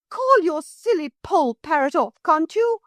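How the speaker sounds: high, shrill-pitched angry demand. A narrator's reading. Motor lady angrily ordering the children to call off the parrot. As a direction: snap at the children.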